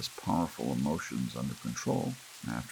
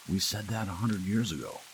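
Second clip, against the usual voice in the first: ordinary delivery, nothing marked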